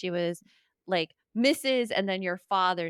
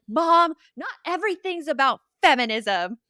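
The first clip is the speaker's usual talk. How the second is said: mock exasperated teen, higher and louder; exasperated daughter; 7 semitones higher, 8 dB louder than usual. Daughter objects to Mom's feminism, mock exasperation. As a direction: imitate a daughter protesting loudly to Mom. Retells daughter's protest to her mother.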